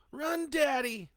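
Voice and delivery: put-on high, loud child's yell. A narrator's reading. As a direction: daughters screaming at their father to run. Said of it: "they yell".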